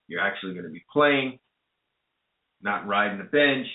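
usual speaking voice

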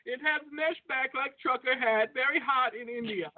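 hammed-up Indian caller voice; Indian accent. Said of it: "Indian voice though".

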